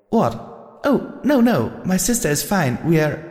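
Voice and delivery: high, bright filly on the phone; chatty little sister; young filly voice. A narrator's reading. Little sister answers a caller, high filly voice.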